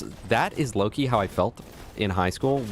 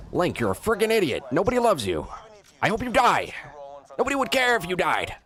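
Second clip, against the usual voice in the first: high mocking voice; 10 semitones higher than usual. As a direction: taunt him in a shrill mock voice. Mock berating in high voice.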